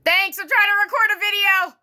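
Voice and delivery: irritated at the interruption; annoyed. Real, not acted. To her housemate who called her to dinner mid-recording, exasperated.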